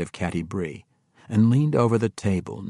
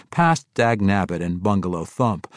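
usual speaking voice

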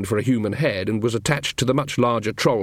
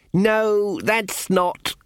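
exasperated correction; 9 semitones higher, much slower than usual. A narrator's reading. Ponder voiced.

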